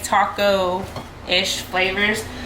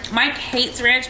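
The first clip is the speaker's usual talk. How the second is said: ordinary delivery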